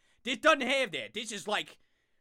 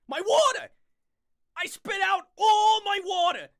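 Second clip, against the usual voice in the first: hammed-up shout; 9 semitones higher, 7 dB louder than usual. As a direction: wail over spilled water theatrically. Parody of blood wail.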